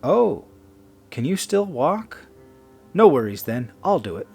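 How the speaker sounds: high, loud mock concern; feigned helpfulness. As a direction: pipe up eagerly, offering to take over. Woman feigns concern for drunk man.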